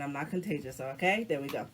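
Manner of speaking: ordinary talk, nothing marked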